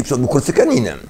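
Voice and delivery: normal talking voice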